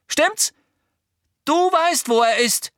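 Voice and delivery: very high, loud pressing; shouted accusation. A narrator's reading. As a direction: push for a confession at full voice. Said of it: "rief Harry".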